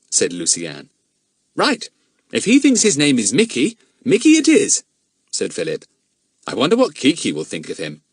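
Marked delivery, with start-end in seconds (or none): 1.6-8.0 put-on boy voice. Philip voiced.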